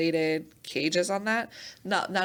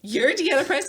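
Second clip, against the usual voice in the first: high, loud, laughing surprise; amazed disbelief; 9 semitones higher, 7 dB louder than usual. Retold or imitated. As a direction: gasp out their disbelief. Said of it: "They're like".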